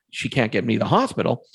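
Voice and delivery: usual speaking voice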